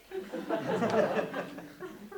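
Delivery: a low, soft chuckle rising at the end; low laugh. Acted. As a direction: laugh it off quietly. Laughter after fashion crisis line.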